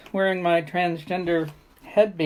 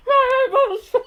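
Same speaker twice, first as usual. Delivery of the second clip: pained yelp; 4 dB louder than usual. Real, not acted. Puppet poked in eye.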